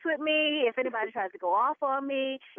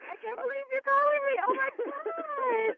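higher, squealing with delight, close to tears; excited fan; 10 semitones higher than usual. Real, not acted. Wife thrilled that the radio host is calling her, overjoyed. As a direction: gasp and gush like meeting your idol. Caller gets a call from her idol.

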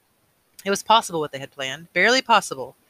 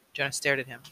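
plain reading voice

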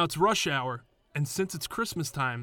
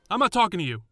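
higher, louder, curt cab driver; dismissive cabbie; 7 semitones higher, 7 dB louder, faster than usual. Retold or imitated. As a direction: wave him off like a rude cabbie. Cabbie brushes him off.

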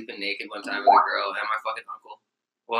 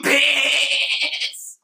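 goat yell; 13 semitones higher than usual. Pretend. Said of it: "What would goats say?".

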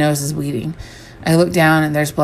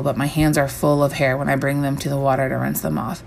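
ordinary delivery, nothing marked

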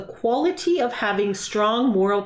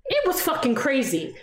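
normal talking voice

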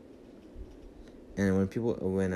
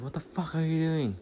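higher-pitched mock outrage; retold outrage; 7 semitones higher than usual. Pilot confronting the driver on the runway, mock outrage. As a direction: imitate an exasperated, incredulous demand.

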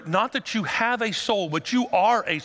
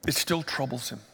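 ordinary talk

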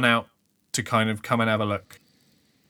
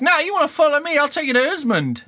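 high, loud competing urchin; pushing in front; kid voice; 5 dB louder, faster than usual. Acted. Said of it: "the other kid goes".

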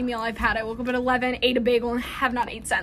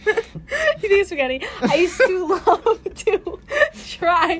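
high, slow, broken up by laughing; 8 semitones higher, 5 dB louder, slower than usual. Real, not acted. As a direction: talk through a fit of laughter. Laughing while trying to go on.

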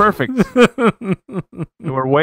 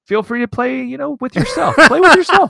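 much higher, laughing through the joke; giggling, higher and louder at the end; laughing innuendo; 12 semitones higher, 6 dB louder than usual. Real, not acted. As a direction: crack up at your own double meaning.

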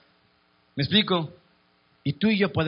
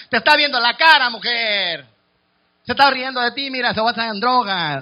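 higher, louder warning; scolding warning; 5 semitones higher, 4 dB louder than usual. Acted. A man warns the woman she's being fooled, high and loud. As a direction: call her out sharply, half in jest.

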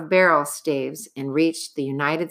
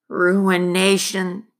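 muttering miner; 2 semitones higher, much slower than usual. Acted. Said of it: "said the miner".

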